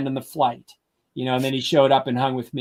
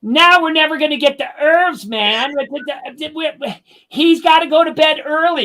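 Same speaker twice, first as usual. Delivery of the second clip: much higher and louder, panicky complaining; freaking out; 12 semitones higher, 5 dB louder than usual. Retold or imitated. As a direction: imitate an old man losing it in the car. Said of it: "Freddie starts freaking out".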